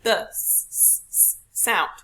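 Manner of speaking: drawn-out hissing s in "s, s"; demonstrating the "s" as a long unvoiced hiss. Shown as a demonstration. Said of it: "Sally sells seashells by the seashore".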